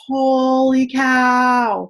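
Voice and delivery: slow, drawn-out amazed exclaim; a stunned, drawn-out holy cow. Retold or imitated. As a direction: let the shock stretch every word out. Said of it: "And I was like".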